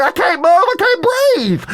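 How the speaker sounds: hammed-up high, loud panicked cry; comic trapped-in-a-tube yell; claustrophobic panic. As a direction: wail it way up high, drawing out the end.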